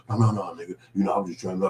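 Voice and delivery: mild imitation of a sheepish friend. The apologizing friend talking to the man he snapped at, softly.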